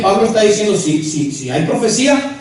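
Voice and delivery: ordinary talk, nothing marked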